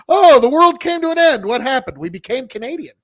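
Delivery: high, loud mock alarm sinking to a mutter; mock shock. Joking about waking up Canadian, mock alarm. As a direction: gasp out the end of the world, then shrug it off.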